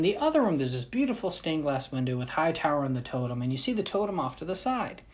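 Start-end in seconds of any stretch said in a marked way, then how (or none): none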